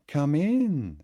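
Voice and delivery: high, loud, cheerful welcome; vehement cheerful. Acted. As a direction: call him in brightly. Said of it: "with a vehement, cheerful note".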